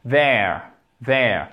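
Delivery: there with the voiced th, said low and careful; model English there. Shown as a demonstration. Teacher answers how to pronounce there. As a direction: demonstrate there as a clear model word. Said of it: "Comment on le prononce?".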